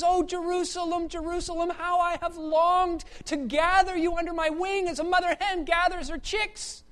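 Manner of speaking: impassioned lament, high-pitched; Jesus. Acted. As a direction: cry out with longing, without sobbing. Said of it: "he starts weeping".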